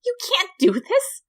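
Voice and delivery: high frantic protest rising; frantic Flowey. A narrator's reading. Flower protests to the human, high and frantic.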